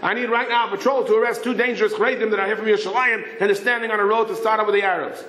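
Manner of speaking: high, urgent rapid-fire radio call. Retold or imitated. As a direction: rattle off the request without pausing. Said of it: "he's talking rapid fire Hebrew".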